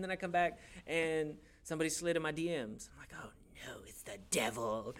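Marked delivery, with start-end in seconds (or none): 3.2-4.9 whispered, spooked oh-no; hammed-up hushed horror; mock dread. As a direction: whisper it in exaggerated alarm. Mock dread at DMs after camp.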